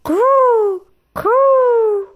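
very high, loud falling coo; dove call. Acted. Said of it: "The doves crowed".

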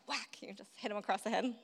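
normal talking voice

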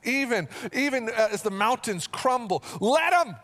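full-force preaching. Acted. Pastor declaring to the church he will not fear, fervent preaching. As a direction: preach it with conviction.

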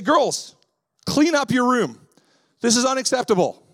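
stern parent barking at daughters to clean up; stern order. Retold or imitated. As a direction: bark the order at the messy kids. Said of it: "I say it nicer than that".

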